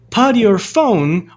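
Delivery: "Put your" run together as "puddy-your", slow and loud; linked T. Shown as a demonstration. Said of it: "Olha só a junção de novo".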